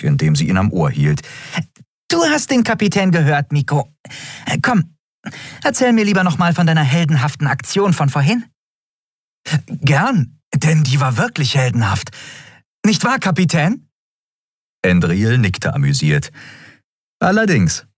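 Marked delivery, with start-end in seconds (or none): 2.1-8.4 put-on higher, quick, coaxing girl's voice. A narrator's reading. Nelen coaxes Miko away from the captain, higher and playful. 9.7-13.9 put-on higher, eager boastful kid. A narrator's reading. Miko boasts to the captain about his heroics, higher and eager. Miko's voice. 17.2-17.8 put-on higher, quick, impatient young captain. A narrator's reading. Captain Endriel urges the crew to move on, higher and quick.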